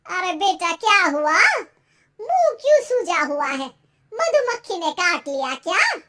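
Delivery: loud, fussing mother voice; exclaiming mother, loud and puzzled; concerned mother. Acted. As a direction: question your sulking son forcefully.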